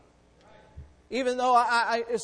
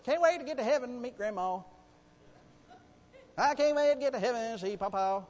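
mocking sing-song; 5 semitones higher than usual. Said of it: "we sing these ridiculous songs".

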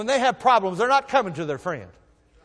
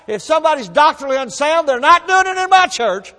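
louder and higher fiery preaching; preaching at full force; 7 semitones higher, 8 dB louder than usual. Acted. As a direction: hammer the doctrine home.

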